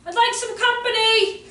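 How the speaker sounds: lightly voiced kid asking; polite little boy. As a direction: ask like a small boy politely calling out. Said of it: "He goes".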